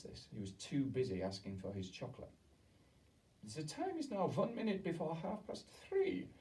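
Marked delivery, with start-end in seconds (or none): 3.4-6.3 high, stately witch announcement; witch announcing. A narrator's reading.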